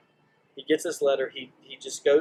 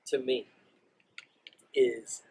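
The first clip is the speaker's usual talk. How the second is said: ordinary talk, nothing marked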